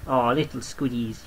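normal talking voice

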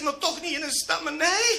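high-pitched comic protest; protesting patient. Acted. Woman's protest, punchline. (hoog, gespeeld verontwaardigd)

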